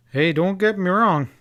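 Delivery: a cowboy's loud, hasty protest; quick protest. A narrator's reading. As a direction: jump in to set him straight. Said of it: "injected Bill".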